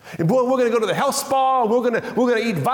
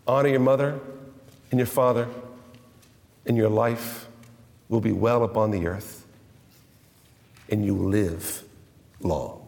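scripture reading; 13 semitones lower, 4 dB quieter than usual. Acted. Reads the commandment.

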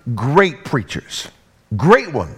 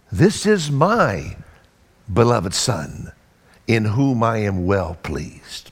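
voice from heaven; 9 semitones lower, 4 dB quieter, slower than usual. Acted. Voice from heaven quoted.

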